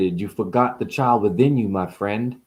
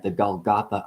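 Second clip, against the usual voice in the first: normal talking voice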